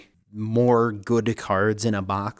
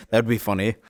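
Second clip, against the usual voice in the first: normal talking voice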